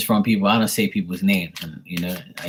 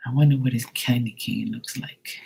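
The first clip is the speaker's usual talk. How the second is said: a dreamy sigh, then fading into a whisper; 4 semitones higher, 4 dB quieter than usual. Retold or imitated. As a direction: sigh and breathe the words out. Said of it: "ladies be looking at me like".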